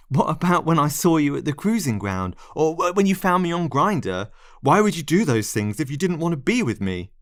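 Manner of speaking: desperate plea. Acted. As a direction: beg for an answer.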